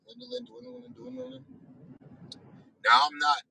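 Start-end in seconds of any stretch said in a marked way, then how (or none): 0.1-1.5 dwindling, dwindling, dwindling, each one trailing off much softer; fading away. Real, not acted. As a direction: let the word fade into almost nothing. His spare time running out.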